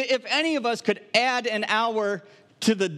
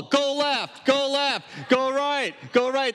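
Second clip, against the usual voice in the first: higher, urgent calls of go left, go right; mock yelling at the ball; 6 semitones higher, slower than usual. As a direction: yell at a bowling ball like a frustrated bowler. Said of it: "They start yelling at the ball".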